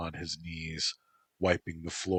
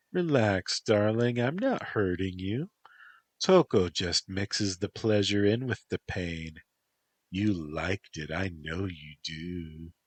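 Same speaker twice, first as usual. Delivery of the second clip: Toko, teasing lover; 3 semitones higher than usual. Acted. Toko voiced in sultry scene.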